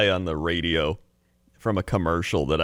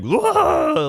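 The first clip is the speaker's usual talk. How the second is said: high, loud cartoon voice yelp; 4 dB louder than usual. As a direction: squawk it like a cartoon character. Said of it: "like that guy".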